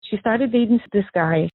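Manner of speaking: ordinary talk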